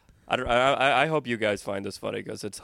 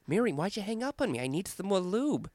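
high hushed prank voice; 9 semitones higher, 5 dB quieter than usual. Retold or imitated. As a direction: whine it breathily down the phone. Prank call to the aunt.

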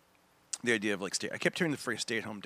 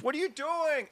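much higher, puzzled; mock wife question. Comic voices his wife catching him, puzzled and high. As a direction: pitch it way up, baffled. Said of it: "My wife turns on the sprinklers".

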